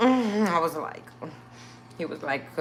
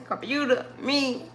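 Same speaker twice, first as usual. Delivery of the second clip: usual speaking voice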